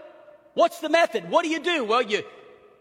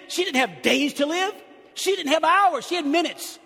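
urgent preaching build; 4 semitones higher than usual. Acted. Preacher building urgency over a dying daughter, fervent. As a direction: drive home the urgency.

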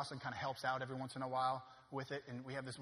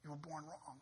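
much softer, plain pitch, falling on born; quiet verdict; 8 dB quieter than usual. Acted. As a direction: drop it to a hush after the shouting. Quiet repeat after shout.

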